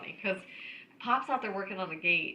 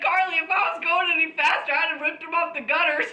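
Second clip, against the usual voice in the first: quoted through laughter, louder; giggling while quoting her; laughing quote; laughing retelling; 8 semitones higher, 7 dB louder than usual. Retold or imitated.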